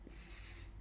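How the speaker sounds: S as a bare drawn-out hiss; just the consonant S, no vowel; isolated S sound. Shown as a demonstration. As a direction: hold S alone as a voiceless sss. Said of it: "has to be pronounced as their own".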